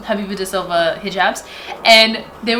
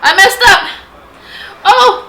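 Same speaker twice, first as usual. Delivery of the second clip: high, loud startled yelp; startled cry; 9 semitones higher, 9 dB louder than usual. Real, not acted. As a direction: cry out at a slip of the brush. Mistake while doing makeup.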